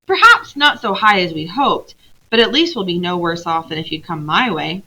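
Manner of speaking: ordinary delivery, nothing marked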